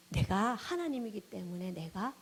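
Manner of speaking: ordinary talk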